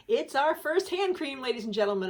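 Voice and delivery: high, loud gleeful reveal; mock-grand announcement. Announcing another hand cream to viewers, mock-grand.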